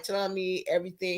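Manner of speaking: normal talking voice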